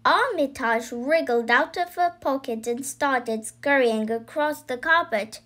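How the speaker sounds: ordinary delivery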